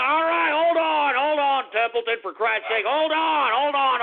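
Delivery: high, strained pleading to wait; fed-up repeated command; exasperated hollering. Real, not acted. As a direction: holler at an impatient pet. Shouting at Templeton to wait.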